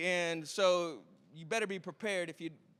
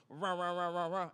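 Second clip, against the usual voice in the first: low, repeated engine cranking sound; rhythmic mouth-made engine revving; imitated engine noise; 4 semitones lower than usual. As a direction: imitate a car engine turning over. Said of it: "he goes".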